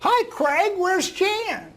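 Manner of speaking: put-on high, slow frail old lady; old woman voice. Guest imitates the elderly reading student, high and slow. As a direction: voice the ninety-four-year-old gently. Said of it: "I'm gonna use a voice that s-, sounds like her".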